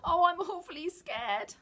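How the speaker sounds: high, slow, frightened Lion; Lion admitting his fear during the fight; scared. A narrator's reading. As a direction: quaver like a cowardly lion. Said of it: "said Lion".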